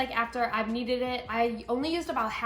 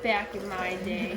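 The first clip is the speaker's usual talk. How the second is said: put-on slow, lower old-timer quote; mocking old-timer; 4 semitones lower, slower than usual. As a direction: drag it out like a grumpy grandpa. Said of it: "Don't come at me with that".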